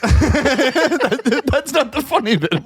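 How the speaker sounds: high-pitched, laughing objection; cracking up mid-complaint; laughing protest. Real, not acted. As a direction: protest helplessly through real laughter. Teller laughing while protesting early laughter.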